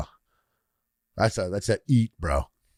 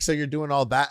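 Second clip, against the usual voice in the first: ordinary delivery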